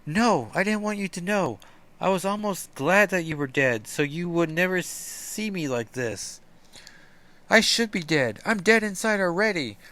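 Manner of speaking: put-on high, despairing young sorceress; ashamed despair. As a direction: confess the shame. Illyana voiced.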